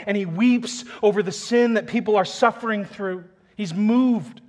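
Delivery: fervent pulpit preaching; full-force preaching. Acted. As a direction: preach it out with rising fervor. Preacher building again.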